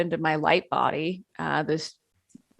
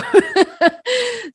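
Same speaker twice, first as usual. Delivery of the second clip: high burst of laughter; 7 dB louder than usual. Real, not acted. Laughing at her own uncertainty.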